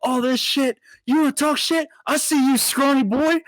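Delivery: hammed-up aggressive heckling; imitated drunk yelling. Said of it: "he just starts yelling".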